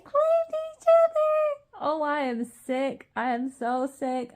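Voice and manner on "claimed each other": high squeal. Real, not acted. Reader squealing at the couple claiming each other, thrilled. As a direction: squeal it high, slow and loud.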